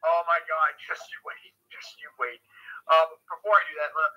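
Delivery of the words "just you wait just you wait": teasing soft whisper. Acted. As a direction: whisper it like a secret. Teasing the story's twist.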